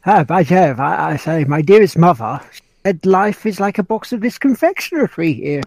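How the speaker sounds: stuffy upper-crust British gent; mock-refined British accent; posh British accent. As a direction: do a hammed-up posh Englishman. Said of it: "the posh Brit guy's like".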